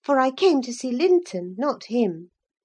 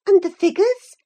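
higher, louder, encouraging question; encouraging cry; 6 semitones higher, 5 dB louder than usual. A narrator's reading. Catherine prods her halted cousin to continue, encouraging cry. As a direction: prompt them on, voice lifting. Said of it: "I cried encouragingly".

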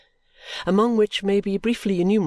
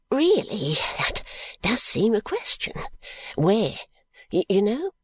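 higher, hesitant wondering; perplexed, wistful; 7 semitones higher than usual. A narrator's reading. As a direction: puzzle it over timidly.

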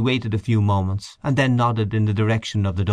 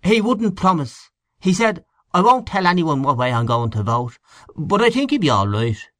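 higher-pitched, animated Irish canvasser; lightly acted storyteller's character; animated Henchy; 7 semitones higher than usual. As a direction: report it with lively, lilting emphasis.

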